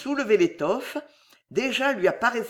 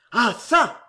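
growled; 4 semitones higher, 4 dB louder than usual. Acted. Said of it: "gronda-t-il".

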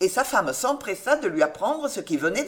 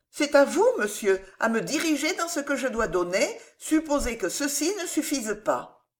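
lightly voiced young woman, higher pitch; higher, lightly acted heroine; earnest young heroine; 7 semitones higher than usual. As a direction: play a willing pupil, a little higher.

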